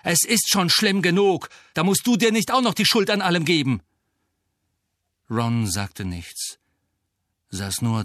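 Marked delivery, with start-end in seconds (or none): 0.0-3.8 higher, louder and fast, still shouting; heated rant; angry outburst. Acted. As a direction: keep scolding him at full force. Harry's outburst continues.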